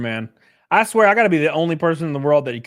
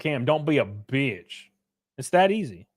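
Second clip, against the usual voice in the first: ordinary talk, nothing marked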